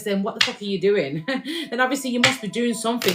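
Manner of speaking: normal talking voice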